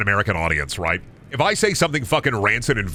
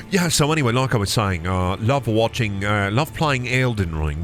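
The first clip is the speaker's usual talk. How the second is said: mock British accent. Streamer resumes his stream as a Brit, mock British accent. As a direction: ramble casually in a put-on British accent.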